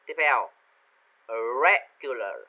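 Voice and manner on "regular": "regular" with a loud REG, then falling; first-syllable stress. Shown as a demonstration. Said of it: "regular mình cũng nhấn âm". (nhấn mạnh âm đầu của "regular")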